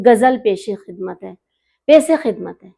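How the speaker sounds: "गज़ल" with a plain hard g, mimicking careless reciters; mock the slip, "गज़ल" with g instead of throaty gh; imitated mispronunciation. Urdu poet mimics how she hears hosts announce a ghazal, mispronounced. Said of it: "मैं सुनती हूँ".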